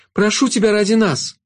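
high, pleading shadow voice. A narrator's reading. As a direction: beg the girl to stop him. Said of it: "взмолилась тень".

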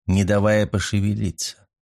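ordinary delivery, nothing marked

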